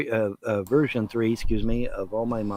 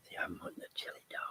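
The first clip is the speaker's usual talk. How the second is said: whispered, quick puppy voice; 19 dB quieter, much faster than usual. Pretend. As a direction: whisper fast for the dog. Speaking for the dog.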